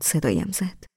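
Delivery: usual speaking voice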